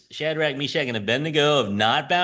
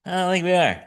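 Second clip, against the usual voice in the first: casual laughing refusal; 7 semitones higher, faster than usual. Retold or imitated. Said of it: "And then they say".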